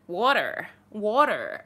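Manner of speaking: "water" in an American accent. Shown as a demonstration.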